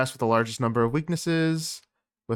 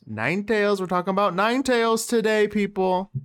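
higher, upbeat show hype; hype announcer; 7 semitones higher than usual. Real, not acted. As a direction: pump up the audience. Hyping the topic to audience.

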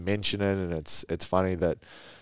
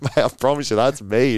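insistent; 6 semitones higher, 7 dB louder than usual. Retold or imitated. Retold insisting to disbelieving kid.

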